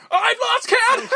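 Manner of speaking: very high, drawn-out wail; hammed-up giddy squeal, breaking into laughter; mock gleeful shriek.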